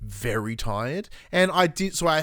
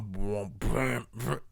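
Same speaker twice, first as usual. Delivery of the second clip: mouthed window-rattle sound effect; imitated rattling windows; 2 semitones lower, 6 dB quieter, much slower than usual. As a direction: mimic windows buzzing to heavy bass. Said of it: "it's my windows going".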